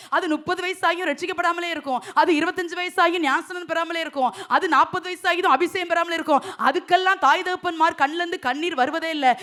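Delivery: normal talking voice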